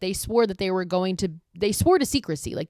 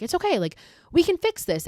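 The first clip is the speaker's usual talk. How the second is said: much higher, rushed reassurance; nervous pleading; 11 semitones higher, 3 dB louder than usual. Retold or imitated. Jessica tries to talk the killer down, high nervous plea. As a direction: squeak it out trying to calm him.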